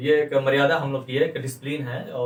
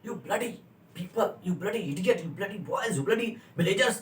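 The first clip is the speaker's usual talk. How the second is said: high, softer, slow spat-out insults; aggressive insults; 6 semitones higher, 5 dB quieter, much slower than usual. Shown as a demonstration. Teacher shows an angry man cursing villagers. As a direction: sneer each insult with contempt. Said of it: "वो aggression इतना गुस्से में बोलेगा".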